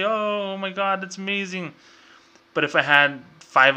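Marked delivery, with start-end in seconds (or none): 0.0-1.7 pitched high, gushing fake delight; hammed-up boss thrilled by a sale; mock excitement. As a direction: cheer like a manager seeing big numbers. Mock manager cheering revenue.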